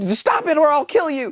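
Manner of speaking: high-pitched hammed-up warning; mock threat. Chloe warning someone before shooting, mock-threatening. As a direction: imitate a frantic warning.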